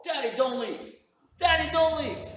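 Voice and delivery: put-on high, loud child's cry; acted desperate pleading. Kid at the door as father leaves, acted desperate wail. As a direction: beg at the top of a child's voice.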